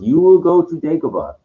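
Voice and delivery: a hint of solemn movie instruction; Obi-Wan. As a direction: imitate a Jedi master sending his pupil off. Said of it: "you know, Obi-Wan goes".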